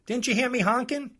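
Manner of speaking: high, loud mocking customer whine; mock irate woman; angry customer. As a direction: mimic her shrill outrage. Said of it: "She says to me".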